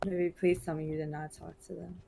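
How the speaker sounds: ordinary talk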